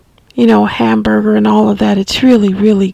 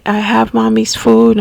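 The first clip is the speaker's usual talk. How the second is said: ordinary talk, nothing marked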